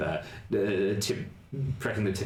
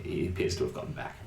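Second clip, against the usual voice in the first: 1 hushed narration; 6 semitones lower, 4 dB quieter, faster than usual. A narrator's reading.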